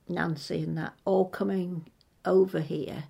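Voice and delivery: usual speaking voice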